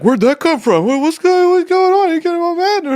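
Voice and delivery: high mocking falsetto; mocking family voice. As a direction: squeak it out as the puzzled family. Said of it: "everybody's like".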